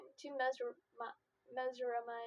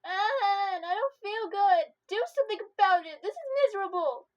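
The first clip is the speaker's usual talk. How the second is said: high, loud miserable whine; nauseous whine; 8 semitones higher, 12 dB louder than usual. Acted. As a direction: complain like about to throw up. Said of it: "I start to cry".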